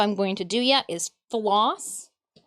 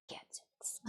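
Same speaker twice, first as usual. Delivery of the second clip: breathy whispered sigh; 23 dB quieter than usual. Real, not acted. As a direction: sigh the word out under your breath. Whispered sigh over gadgets.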